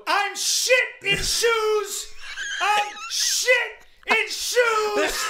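slow, high self-loathing yell; overwrought shouting. Real, not acted. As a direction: wail each word in comic despair. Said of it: "Calm down".

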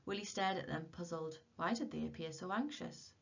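ordinary delivery, nothing marked

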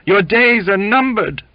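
high, loud doom-laden yell. A narrator's reading. Cook threatens Jimmy with death, loud yell. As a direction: yell the warning like a prophet. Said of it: "The cook yelled".

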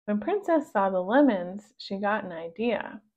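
ordinary delivery, nothing marked

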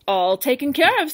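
loud and pleased with himself; loud proud reply; proudly. Acted. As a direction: boast that it's all done. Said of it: "said Bob proudly".

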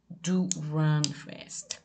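"Do run fast" drawn out slowly; slow emphatic order. Shown as a demonstration. Teacher demonstrates a slow, insistent order. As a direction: stretch each word, insisting. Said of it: "Mas dá uma ênfase".

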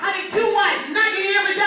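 emphatic preaching. Real, not acted. Preacher warns about having two wives, high emphatic preaching. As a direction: drive it home to the congregation.

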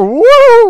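loud, high, drawn-out "woo"; sudden soaring whoop; dizzy whoop. Real, not acted. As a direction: whoop as if the view is spinning wildly. Dizzy at wildly sensitive game controls.

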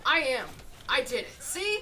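loud, high, punchy boast; triumphant crowing. Real, not acted. Gamer proving own boast, loud triumphant crowing. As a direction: crow it, shooting up at the end. Triumphant after gloating about skill.

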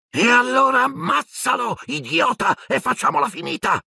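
very high, screeching order; dark lord screaming at his servant to kill the boy; shrill furious shout. Acted. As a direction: shriek at the useless servant. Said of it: "gridò Voldemort con la sua voce stridula".

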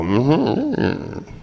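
a soft, knowing "Mm-hmm" from a shrink; mock psychiatrist "mm-hmm". A speaker mimicking a psychiatrist noting an answer, with a soft mm-hmm. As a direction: hum it like an analyst taking notes. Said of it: "And they go".

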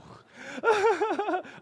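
startled burst of laughter, high and loud; a caught-off-guard laugh; sudden high laugh. Real, not acted. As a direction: laugh out in surprise. Something interrupts the sermon.

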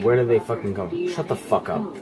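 normal talking voice

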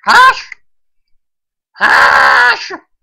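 very loud barked shout. Real, not acted. Player calling out the purple one, full shout. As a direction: yell the single word, drawing out the last one. Yelling the colour of the killer.

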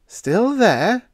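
high, loud disbelieving rise; incredulous echo. A narrator's reading. Radioman echoes incredulously.

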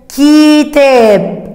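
كتاب stretched very slowly; slow teacher demo of كتاب; slow sounding out. Shown as a demonstration. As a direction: hold كتاب out for children to hear the كاف. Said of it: "صوت حرف الكاف".

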